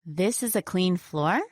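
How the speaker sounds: doubting question with a strong rise on "floor"; sarcastic questioning. Shown as a demonstration. Co-teacher doubting that it's clean, sarcastic questioning rise on "floor". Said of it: "with a stronger questioning intonation".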